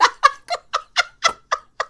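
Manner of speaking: a high, delighted squeal of a laugh. Real, not acted. Laughing at a viewer's sign, high squeal.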